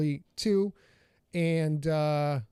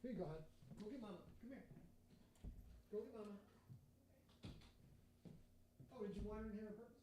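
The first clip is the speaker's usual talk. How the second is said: far off mic; 4 semitones higher, 25 dB quieter, faster than usual. Real, not acted. Talking softly to the dogs off-mic.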